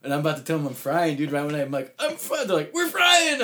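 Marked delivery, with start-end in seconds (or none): 2.0-2.5 much higher, squeaky stoner voice; mock high squeal. Telling friends how he announced he was tripping, high squeaky voice. 2.7-3.4 much higher, squeaky stoner voice; mock high squeal. Telling friends how he announced he was tripping, high squeaky voice. Friends reply in retold story.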